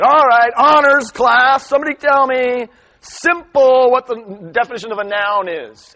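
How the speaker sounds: loud, high booming teacher voice; stern teacher. Retold or imitated. Teacher challenging his honors class on nouns, stern and loud. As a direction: bark it out to a classroom, slowing on key words.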